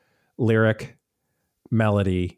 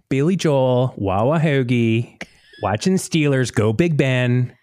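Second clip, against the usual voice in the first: put-on slow, drawn-out Pittsburgh accent; improvised Pittsburgh fan voice; slower than usual. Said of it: "you gotta do the, um, accent".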